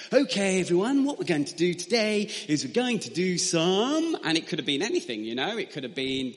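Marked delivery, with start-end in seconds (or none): 0.1-4.2 bright, louder call to the whole class; nursery teacher voice. Retold or imitated. Nursery teacher announcing the day's activity to the children, cheery call. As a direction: sing it out like a teacher gathering toddlers.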